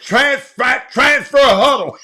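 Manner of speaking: loud, high mock Willie yell; mock Willie shout. Host mocking Willie's transfer huddle line, loud. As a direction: ham up the misspoken word. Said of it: "that man said".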